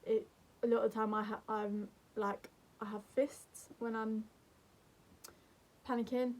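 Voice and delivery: ordinary talk, nothing marked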